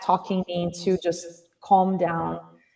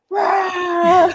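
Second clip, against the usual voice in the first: crowd cheer; 11 semitones higher, 6 dB louder than usual. Retold or imitated. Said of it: "everybody's like".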